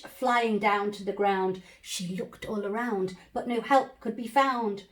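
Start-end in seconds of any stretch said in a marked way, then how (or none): none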